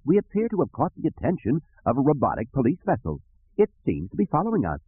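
high, prim robot-servant voice; fussy droid. Acted. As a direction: report trouble as a formal, fretful droid. Droid figure in the story.

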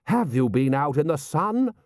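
affronted aristocrat's question; indignant lord. A narrator's reading. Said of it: "roared Lord Rust".

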